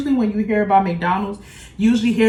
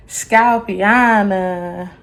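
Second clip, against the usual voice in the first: drawn out, showy and louder; slow showy announce; 4 dB louder, much slower than usual. Real, not acted. Showing off the finished hair to viewers, slow showy reveal. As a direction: stretch the name out like a reveal.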